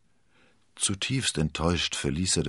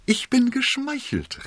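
very high, drawn-out queenly mock delight; 4 dB louder than usual. A narrator's reading. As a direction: gush slowly in a high voice, making fun of him. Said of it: "blickte den Kardinal spöttisch an".

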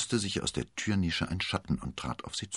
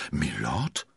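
stunned question with a sharp rise; dismayed. A narrator's reading. D'Artagnan learning the stranger's rank, dismayed. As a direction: echo it in disbelief. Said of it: "wiederholte D'Artagnan bestürzt".

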